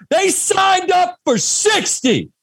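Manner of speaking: high, drawn-out yell; retold locker-room yell. Center breaking the silence of the halftime locker room, yelling. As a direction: imitate a player's battle cry, slow and high. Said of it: "he breaks the silence and he yells".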